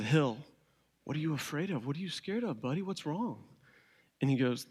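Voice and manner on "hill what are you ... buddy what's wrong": put-on softer, gentle questions to a frightened toddler; soft fatherly comfort. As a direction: coax it out of him gently. Father soothing scared son at night.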